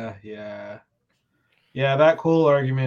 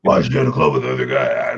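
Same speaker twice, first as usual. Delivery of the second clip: loud, very low, distorted put-on voice; mock an upset boyfriend at full volume; hammed-up jealous complaint; jealous outburst; 6 dB louder than usual. Acted jealous outburst of contestant.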